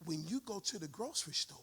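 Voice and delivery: soft, intimate sermon voice; quiet preaching. Real, not acted. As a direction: bring it down to a near hush. Preacher drops voice after climax.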